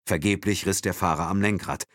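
usual speaking voice

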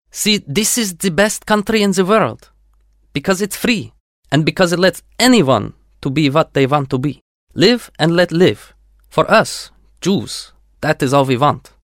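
put-on heartfelt Russian-accented voice. A narrator's reading. Yuri speaks with Russian accent.

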